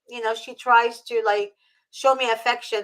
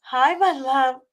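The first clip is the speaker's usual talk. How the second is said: slow, higher cooing to the cat; 4 semitones higher, slower than usual. Real, not acted. Guest cooing at the rescued cat, slow and tender. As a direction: melt over the cat.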